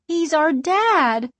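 put-on high, slow, small girl's voice; small, high child's voice; tiny little voice. A narrator's reading. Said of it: "in a tiny little voice".

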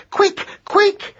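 very high, urgent squeak; panicked high command; urgent hiss. A narrator's reading. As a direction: hurry them along in a high, tight voice. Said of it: "hissed Madeline".

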